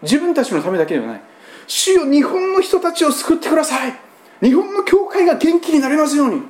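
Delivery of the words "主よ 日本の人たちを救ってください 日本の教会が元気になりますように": fervent prayer. Retold or imitated. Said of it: "そうやって祈ってるんですよ".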